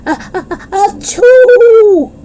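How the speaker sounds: high rising ah-ah-ah then loud achoo; huge sneeze. Acted. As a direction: build up and explode into a sneeze. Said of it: "such a strong sneeze".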